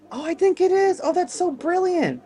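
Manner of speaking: high, excited wonder; thrilled. Real, not acted. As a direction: marvel out loud. Thrilled at discovery.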